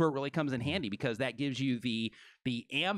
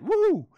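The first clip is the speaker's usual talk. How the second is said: high, loud "woo"; celebratory high-pitched cheer; excited whoop; 11 dB louder than usual. Real, not acted. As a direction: whoop with a big jump in pitch. Cheering thumbs coming up.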